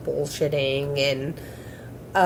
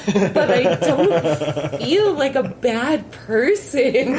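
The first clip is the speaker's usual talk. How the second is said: slow, giggly stoned quote, higher; laughing while quoting; 5 semitones higher, slower than usual. Retold or imitated. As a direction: giggle through it slowly like you're baked. Stoned retelling own words.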